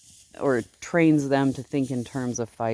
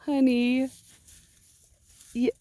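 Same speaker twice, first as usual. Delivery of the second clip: much higher cooing "Honey"; 10 semitones higher than usual. Real, not acted. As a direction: coo it to the sheep. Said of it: "me blethering and baby talking".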